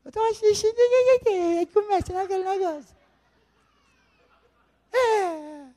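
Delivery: high, sweet, giggly partner voice; giggly late night call. Retold or imitated. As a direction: coo it like a smitten girlfriend.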